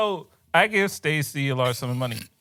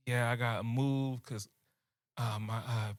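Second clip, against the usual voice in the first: hushed retold plea; 5 semitones lower, 6 dB quieter than usual. Retelling girlfriend's quiet phone excuse.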